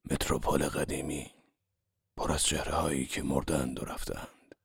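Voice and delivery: a touch of mourning for lost friends; sadly. Acted. Wolfshiem mourning dead friends at the Metropole, softly sad. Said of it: "غمگنانه گفت".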